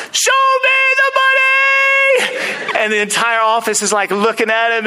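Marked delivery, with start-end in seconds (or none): 0.1-2.8 very high, stretched-out mock scream; lost his mind. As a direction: yell it like an agent losing his mind. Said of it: "he's lost his mind".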